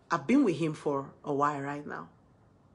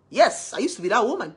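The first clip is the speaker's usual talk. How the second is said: usual speaking voice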